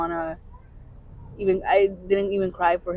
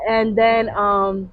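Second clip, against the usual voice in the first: usual speaking voice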